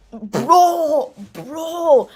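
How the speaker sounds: loud high laughing bro; shouted bro. Retold or imitated. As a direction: yell it, cracking up. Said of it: "I could not believe Kai said".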